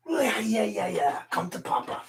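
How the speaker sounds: normal talking voice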